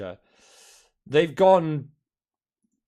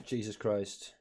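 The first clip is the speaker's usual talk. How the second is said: ordinary talk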